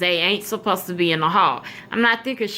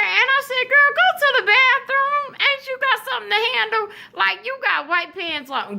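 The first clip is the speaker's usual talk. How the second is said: high, urgent hurry-up to a friend; telling my best friend to hurry to the bathroom, high and urgent; 14 semitones higher than usual. Retold or imitated. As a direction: push her off to the bathroom, high and quick.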